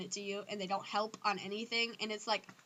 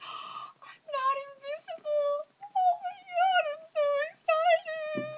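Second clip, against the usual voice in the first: very high, loud and slow, near-crying squeal; overjoyed, hammed-up teary squeal; mock excited squeal; 9 dB louder, slower than usual. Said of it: "all freaking out because you're like".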